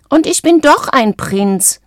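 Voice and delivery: indignant high-pitched squawk; high squawking frog. A narrator's reading. Frog insisting to teasing friends, high squawking. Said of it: "quäkte Valentino mit einer noch höheren Stimme".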